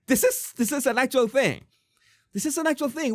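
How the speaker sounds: high-pitched, amazed disbelief; astonished. Real, not acted. Stunned that the statement is real.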